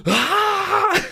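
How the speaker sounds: high, loud startled mock scream; imitated freaked-out scream. Neighbor hit by loud text-to-speech at the door, mock scream. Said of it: "Then he's super freaked out".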